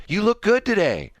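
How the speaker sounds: high-pitched, smiley compliment in imitation; lady impression. Host voicing the booth lady complimenting him, high mock-friendly voice. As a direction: mimic a chatty, over-friendly stranger. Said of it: "And she goes".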